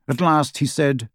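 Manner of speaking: usual speaking voice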